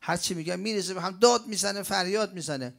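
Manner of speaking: high, loud, fast exasperated whining; a worn-out mother venting all at once; frantic complaining parent. Retold or imitated. Preacher voicing a parent fed up with the child, exasperated. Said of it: "میگه".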